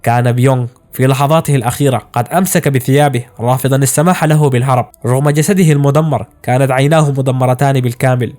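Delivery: usual speaking voice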